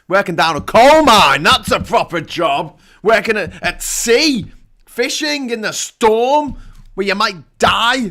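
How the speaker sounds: loud, high comic bluster with punched key words; mock rant. Host preaching what counts as a proper job, mock rant. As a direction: hammer out the dangerous jobs in mock outrage.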